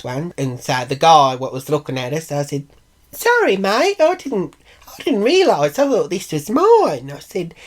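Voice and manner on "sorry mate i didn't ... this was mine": high-pitched feigned innocence; exaggerated high, loud sorry; put-on apology. Acted fake apology to the man.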